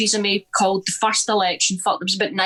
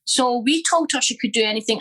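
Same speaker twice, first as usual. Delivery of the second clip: ordinary talk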